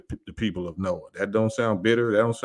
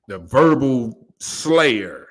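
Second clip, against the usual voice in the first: slow emphatic; 3 semitones higher, 4 dB louder, much slower than usual. Real, not acted. Boasting to the audience about critical thinkers, slow and proud. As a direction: stretch each word out, slow and proud.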